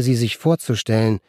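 plain reading voice

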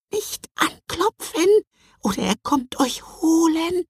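very high, hushed tiny-creature voice; excited whisper. Acted. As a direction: warn in an anxious squeaky hush. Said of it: "wisperte er aufgeregt".

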